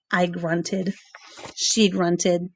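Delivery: ordinary delivery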